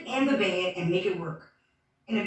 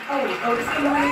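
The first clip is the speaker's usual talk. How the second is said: ordinary talk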